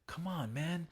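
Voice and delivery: high, urgent coaching voice; motivational pep talk. Acted. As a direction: urge him on like a motivating dad. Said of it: "it's kinda like motivational speech".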